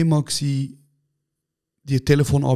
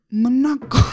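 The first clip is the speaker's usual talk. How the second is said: higher pitched playful call; high mock call; 9 semitones higher, slower than usual. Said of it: "Er so". (hoch und spöttisch rufen)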